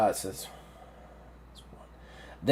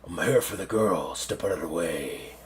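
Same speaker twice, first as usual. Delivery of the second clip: low, soft creature threat; menacing octopus; 7 semitones lower, 5 dB quieter than usual. Acted. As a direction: quietly order them aside in a deep octopus voice. Octopus confronting the player.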